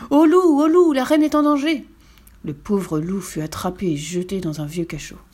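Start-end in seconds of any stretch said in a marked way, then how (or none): 0.1-1.9 loud, high-pitched alarm shout; yelling guard. A narrator's reading. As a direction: yell out the alarm like a panicked guard. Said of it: "le garde se mit à hurler". (hurler l'alerte d'une voix aiguë)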